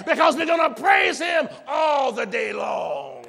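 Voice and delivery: loud, rising preacher's call to praise; full-force preaching. Acted. Pastor urging the church to praise, at full force. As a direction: preach it hard to the congregation, building.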